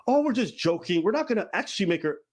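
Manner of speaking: high, breezy mock defense; mock excuse. Streamer mocking how they'd explain it in court, high and dismissive.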